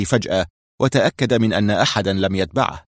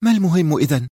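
higher female voice, worried; worried Mona; 7 semitones higher than usual. A narrator's reading. Mona worriedly asks Hussam what matters, higher voice. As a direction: ask with concern. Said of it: "سألته منى في قلق".

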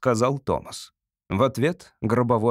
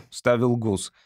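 ordinary delivery, nothing marked

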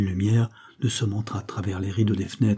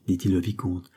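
plain reading voice

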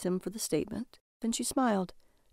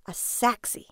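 whispered, breathy; 3 semitones higher, slower than usual. A narrator's reading. Lily whispering the word.

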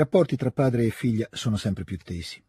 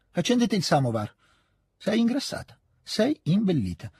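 lightly voiced old lady, higher on the greeting; order, then fuss over the girl; brusque old lady; 9 semitones higher than usual. (vecchia signora che accoglie Natascia, più acuta)

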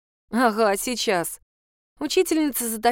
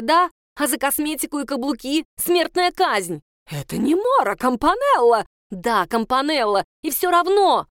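higher and louder sparring; heated argument; 5 semitones higher, 4 dB louder than usual. Acted. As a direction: argue back at full voice. Teacher and pupil shouting argument. (спорит на повышенных тонах)

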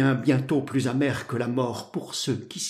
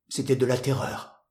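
plain reading voice

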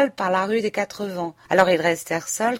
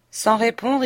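usual speaking voice

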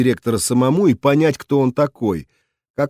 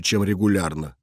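plain reading voice

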